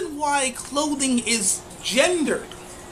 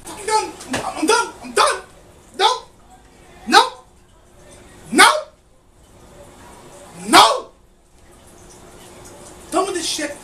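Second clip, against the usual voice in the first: yelling; 6 semitones higher, 4 dB louder than usual. Real, not acted. Final exasperated outburst.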